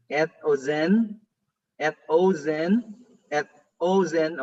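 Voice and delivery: slow et ozen, rising on ozen; stress on ozen. Shown as a demonstration. Pastor drills the class on the accent of ozen, slow and rising. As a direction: drill et ozen slowly, leaning on ozen. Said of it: "take note of the accent mark here".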